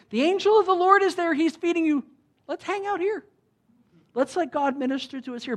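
high-pitched voicing of someone keen to stay; eager mock plea. Imagined Elijah urging to stay and hang out, eager mock plea. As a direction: voice it high, starting loud, then easing off.

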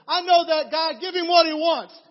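high, loud booming command; manager's loud order. Retold or imitated. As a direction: boom it out like the boss. Manager overrides the clerk.

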